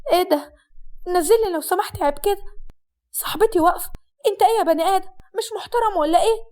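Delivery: high-pitched, indignant protest. Acted. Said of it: "حسناء بصوت عالي اتكلمت وقالت". (بصوت عالي ومعترض)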